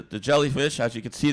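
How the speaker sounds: normal talking voice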